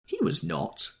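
high-pitched denial; denying. A narrator's reading. Daisy contradicting Tom, denying. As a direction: deny it, pitched well up. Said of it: "she denied".

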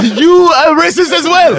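high, loud, drawn-out mock outrage; outraged accusation. Betrayed passenger to storyteller, outraged accusation. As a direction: cry it slowly, hammed-up and shrieky.

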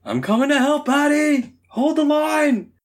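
high, slow heroic call; rally the ally, held and high; heroic battle cry. Acted.

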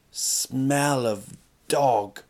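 very slow "smell of dog", each word stretched; drawn-out emphasis. Real, not acted. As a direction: drag out "smell" and "dog" for comic weight. Repeats the phrase for comic emphasis.

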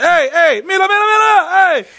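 very high-pitched frantic calling out; retold panicked screaming. Trapped rider to Mexican operator, mock panicked screaming. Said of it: "I start screaming shit".

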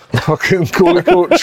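slow, laughing punchline. Real, not acted. Joking about a goalie coach, laughing. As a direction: drag it out while laughing.

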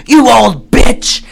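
high, drawn-out mock yell; retold yelling. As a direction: spit out the insult slowly, hammed-up. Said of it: "she's yelling at her".

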